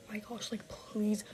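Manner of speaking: hushed, whispered begging; acted dread, barely audible; whispered plea. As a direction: whisper it like a desperate little prayer. Retold frightened pleading on the phone.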